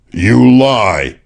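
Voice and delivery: all but scream it; near scream. A narrator's reading. Pennington at Sexton, nearly screaming. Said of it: "Pennington all but screamed".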